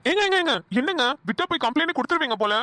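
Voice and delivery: high-pitched, flustered objection; alarmed protest. Acted. Husband threatened with a police complaint, alarmed protest. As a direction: cut in urgently to stop them.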